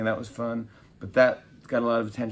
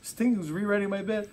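higher, panicky mock voice; mock freakout; 11 semitones higher than usual. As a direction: play a writer freaking out, half-joking. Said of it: "He's like".

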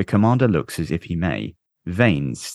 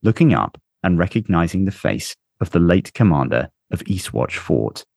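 ordinary delivery, nothing marked

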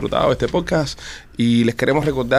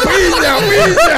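much higher laughing mock yell; shouting; 6 dB louder, much slower than usual. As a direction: cry it out through laughter. Calling out his safe word.

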